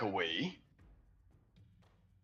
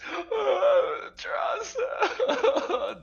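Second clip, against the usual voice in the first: high, anguished wail of a name; frightened cry; 3 dB louder, much slower than usual. Acted. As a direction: cry out in fear. NPC crying out to Draza.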